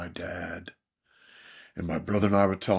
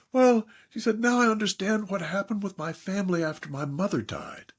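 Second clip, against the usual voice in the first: tearful woman; 9 semitones higher than usual. Retold or imitated.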